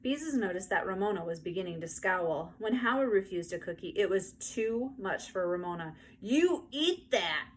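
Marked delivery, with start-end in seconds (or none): 6.2-7.5 put-on high, slow, bossy little-girl demand; drawn-out bossy child's command; bossy shout. A narrator's reading. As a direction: order him to eat it like a furious four-year-old. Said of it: "she shouted".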